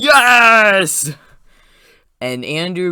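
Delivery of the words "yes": loud, high, drawn-out triumphant yell; cheering crowd. Retold or imitated. Fan re-enacting his theater's reaction to the rescue, loud ecstatic cheer. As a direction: whoop it like a whole audience erupting.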